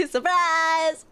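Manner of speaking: high, bright surprise call; spring it on them; playful surprise. Pretend.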